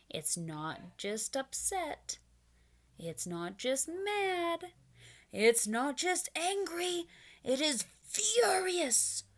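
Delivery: slow build from upset to loud furious; building intensity. Shown as a demonstration. Teacher building from upset up to furious. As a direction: climb each word bigger. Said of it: "furious is a very, very big feeling".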